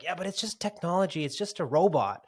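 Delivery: put-on high, whiny kid protest. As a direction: argue back like a child being told off. Retold kids protesting to their grandfather.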